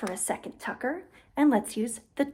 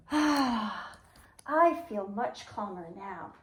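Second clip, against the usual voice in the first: calm relief; 6 semitones lower, 3 dB quieter, much slower than usual. Acted. Turtle calmed down.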